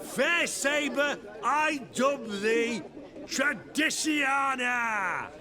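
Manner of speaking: slow, high, ceremonial naming; solemn dubbing. Acted. Squire naming his new sabre, mock solemn ceremony. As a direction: dub the blade with pomp.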